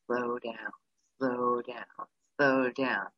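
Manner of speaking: soft, low remembered voice; a hushed reminder to slow down; inner voice. Retold or imitated. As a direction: murmur it to yourself. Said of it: "I kept hearing his voice".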